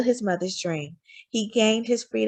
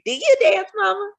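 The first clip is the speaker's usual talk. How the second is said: put-on little boy voice; 12 semitones higher, 4 dB louder than usual. A narrator's reading. Child asks mother.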